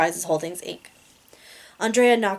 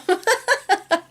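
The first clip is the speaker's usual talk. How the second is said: high, sudden giggle; 12 semitones higher, 4 dB louder than usual. Real, not acted. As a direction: burst into a giggle. Breaks into laughter mid reading.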